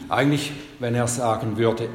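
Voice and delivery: ordinary talk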